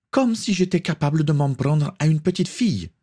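high-pitched indignant outcry; crying out. A narrator's reading. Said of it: "s'écria Tatiana".

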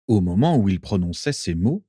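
ordinary delivery, nothing marked

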